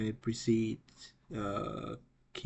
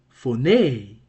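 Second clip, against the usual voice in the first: "phoneme" as fo-NAY, last syllable raised and loud; accent on last syllable; 10 dB louder than usual. Shown as a demonstration. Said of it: "So the pronunciation will be".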